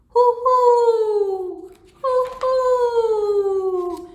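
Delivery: loud, high owl hooting; long falling hoots like an owl. Acted. Storyteller answering what the owl says, loud high hoots. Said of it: "What's that owl say?".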